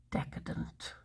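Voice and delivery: hushed, whispered relish; barely voiced, mock-indulgent; savouring whisper. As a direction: whisper it as if it's a guilty luxury. Savouring the breakfast idea.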